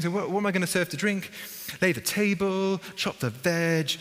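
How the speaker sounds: high, harried hostess listing chores; an overwhelmed sister muttering chores to herself; fretting Martha. Retold or imitated. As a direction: flap about like everything's late. Voicing Martha's panicked prep.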